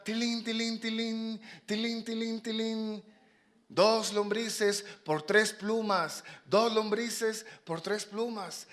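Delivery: high, sing-song bell and sales call; street vendor's cry. Acted. Fox hawking worms for feathers, sing-song. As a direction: chime the tilín, then stretch out the offer.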